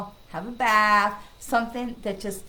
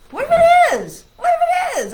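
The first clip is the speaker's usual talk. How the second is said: excited; 14 dB louder than usual. Real, not acted. Excited challenge to the saying.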